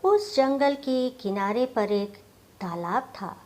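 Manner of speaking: ordinary delivery, nothing marked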